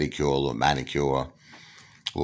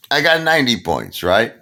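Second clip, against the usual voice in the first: excited; 7 semitones higher, 5 dB louder than usual. Real, not acted. Excitement at climbing score.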